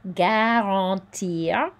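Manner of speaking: Garantir with the French R sounded, slow; careful French Garantir, the R heard; French pronunciation. Shown as a demonstration. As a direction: say Garantir slowly, rolling the French R. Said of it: "we do hear this R here".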